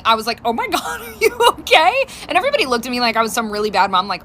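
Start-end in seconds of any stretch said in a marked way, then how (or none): 0.4-1.9 asking through real laughter, pitched up; giggly mock concern, high; laughing. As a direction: laugh out the question you can't take seriously. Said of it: "I burst out laughing".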